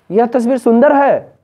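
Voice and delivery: loud, high exclamation of wonder; astonishment. Shown as a demonstration. Teacher demonstrating exclamation to class, loudly astonished. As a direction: burst out amazed, falling on है. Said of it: "ये आश्चर्य हो गया".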